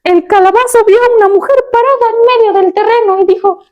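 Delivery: high, squeaky cartoon pumpkin voice. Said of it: "tienes que hacer voz de calabaza".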